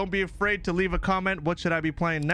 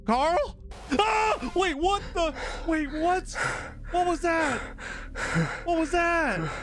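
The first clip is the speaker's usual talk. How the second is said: high, alarmed repeated questions; jolted by a scare, rattled; real startled fright calling out; startled panic; 10 semitones higher, slower than usual. Real, not acted. Sudden scare in horror game.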